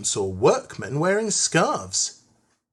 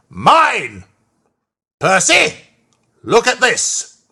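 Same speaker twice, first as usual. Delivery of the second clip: loud, raised indignant outburst; angry Fat Controller; 8 semitones higher, 9 dB louder, slower than usual. A narrator's reading. Fat Controller seizing his top hat, loud angry outburst. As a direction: bellow it, word by word. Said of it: "seized the top hat and said".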